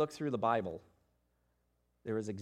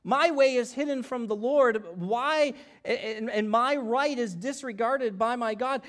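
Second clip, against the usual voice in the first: anguished lament; 9 semitones higher, 7 dB louder than usual. Acted. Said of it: "Rather, look at the verse".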